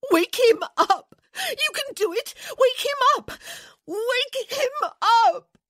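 very high, loud, drawn-out shrieks; hysterical screaming. A narrator's reading. Wife launching herself at friend, screaming hysterically. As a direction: scream the plea, wailing longer at the end. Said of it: "she screamed".

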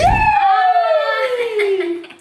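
loud high shrieking laugh; excited shriek laugh. Real, not acted. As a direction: shriek with joy at winning. Wins a fidget.

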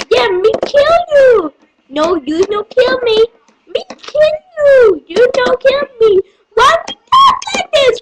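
high shouting match, rising further; escalating bickering. Acted. Two fighters arguing over killing, climbing into high yells.

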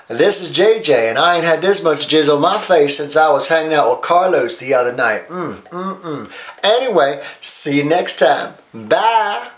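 high, sassy hostess voice pitching a product; sassy infomercial host JJ. Acted. Host to viewers, sassy high-pitched pitch. Plays drag character JJ hosting infomercial.

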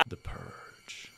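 hushed, ominous whisper; spooky whisper. Pretend. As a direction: whisper it like a horror trailer. Ominous movie-title whisper.

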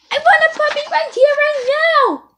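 high, loud, fast demand; demanding pigeon. Acted. Pigeon demands the reader give him a puppy, loudly. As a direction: stamp your feet and demand it now.

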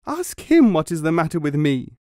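a touch of wailing, accusing; crying out. A narrator's reading. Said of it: "she cried".

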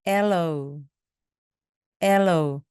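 "aloe" with AL strong and the end falling away; stress on first syllable. Shown as a demonstration. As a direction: hit the front of "aloe". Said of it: "맨 앞에 강세가 있습니다". ("aloe"의 맨 앞에 강세)

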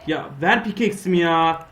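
loud, heated demand; angry demand. Real, not acted. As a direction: yell for your pickaxe back. Demanding pickaxe back. (öfkeli talep)

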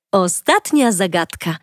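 ordinary delivery, nothing marked

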